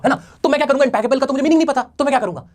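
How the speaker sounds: ordinary talk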